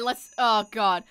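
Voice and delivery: normal talking voice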